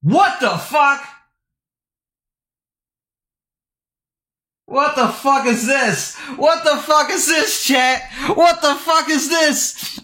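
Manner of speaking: drawn-out, high, incredulous yelling; what the fuck, stretched out in outrage; outraged disbelief. Real, not acted. Outraged reaction to tweet.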